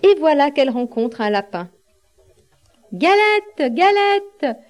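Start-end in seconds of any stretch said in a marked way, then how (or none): 2.9-4.6 high-pitched rabbit calling out; storybook rabbit, high voice without real shouting; crying-out rabbit. A narrator's reading. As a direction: call out high as a hungry rabbit. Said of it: "crie le lapin".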